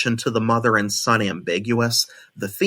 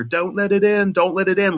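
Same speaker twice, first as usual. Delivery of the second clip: put-on much higher child's voice, begging over and over; 10 semitones higher than usual. Quoting the son begging his mother in a high pleading voice. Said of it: "when he begs her".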